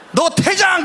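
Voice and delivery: high, loud translated ejection; umpire shouting. Retold or imitated. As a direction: yell the ejection at him. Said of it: "그런 거예요".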